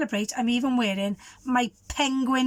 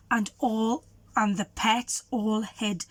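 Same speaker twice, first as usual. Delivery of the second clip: plain reading voice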